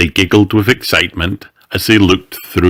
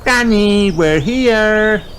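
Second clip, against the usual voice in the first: put-on high, raised childlike call; 4 dB louder, slower than usual. Peppa knocks and calls to Granny, excited call. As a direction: call excitedly through a front door.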